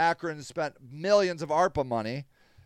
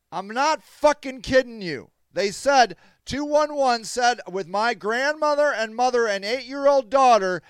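loud, high-pitched angry outburst; real anger; 5 semitones higher, 5 dB louder, slower than usual. Real, not acted. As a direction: let the fury burst out on each word. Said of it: "Sorry, I'm so angry".

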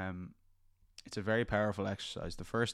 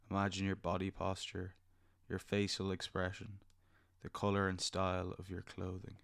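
soft spa whisper; 4 dB quieter than usual. Acted. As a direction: gently lead them into calm. Leads a guided meditation.

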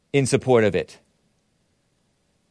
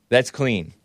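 normal talking voice

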